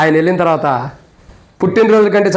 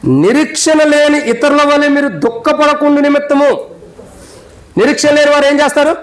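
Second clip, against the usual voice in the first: raised, impassioned pulpit voice, driving each question home; preaching at full force; 9 semitones higher than usual. Acted. Pastor to funeral congregation, preaching at full force.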